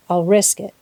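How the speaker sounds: usual speaking voice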